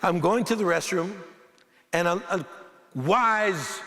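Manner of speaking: usual speaking voice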